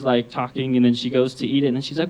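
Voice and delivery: normal talking voice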